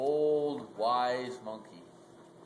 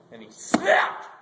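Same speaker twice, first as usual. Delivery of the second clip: loud dramatic snap; 2 semitones lower, 10 dB louder than usual. A narrator's reading. Climax of the story.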